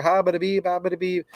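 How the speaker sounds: lighter nonsense syllables like an auctioneer; mock auctioneer gabble. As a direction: rattle off gibberish the way an auctioneer does. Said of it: "broken English like an auctioneer".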